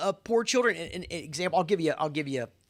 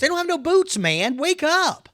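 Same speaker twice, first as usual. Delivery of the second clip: high, loud exasperated cry; real outburst; 11 semitones higher, 7 dB louder than usual. Real, not acted. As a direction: let it burst out, fed up. Ranting about poor kids.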